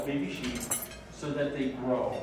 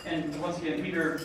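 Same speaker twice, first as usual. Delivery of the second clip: normal talking voice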